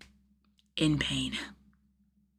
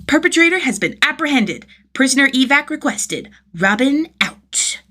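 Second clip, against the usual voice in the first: higher, louder mock police radio call; official radio report; 7 semitones higher, 5 dB louder than usual. A narrator's reading. Tim reports to Batman, proud mock police radio. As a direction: play the serious crime fighter on the walkie.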